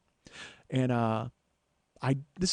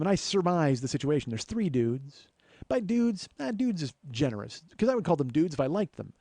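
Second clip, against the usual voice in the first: normal talking voice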